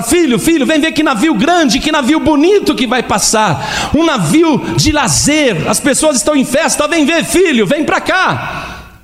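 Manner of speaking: chamado alto e alegre, bem mais agudo; pai chamando animado. Acted. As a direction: chama o filho todo empolgado com o navio. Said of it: "E ele começa a falar". (pai chamando o filho, animado)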